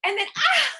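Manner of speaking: high loud yelp into laughter; mock hot-sand yelp. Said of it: "the sand is really hot".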